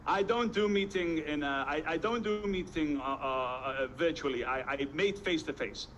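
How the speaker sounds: halting, badly faked Russian accent; mock Russian accent with groping hesitations. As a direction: stumble through it in a clumsy Russian accent. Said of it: "this is my very bad Russian accent".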